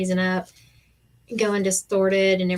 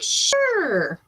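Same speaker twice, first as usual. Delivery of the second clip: sarcastic sure; 11 semitones higher than usual. Retold or imitated. Sarcastic reply to husband.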